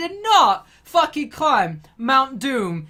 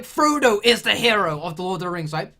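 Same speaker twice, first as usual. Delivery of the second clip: ordinary talk, nothing marked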